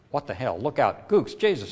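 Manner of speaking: panicked soldiers yelling; shouting. A narrator's reading. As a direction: yell the alarm in panic. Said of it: "Shouts and shots suddenly erupted".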